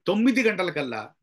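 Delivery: firm weight on తొమ్మిది; emphatic stress. Shown as a demonstration. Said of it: "అంటే దాన్ని emphasis చేస్తున్నాం".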